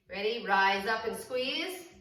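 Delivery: ordinary talk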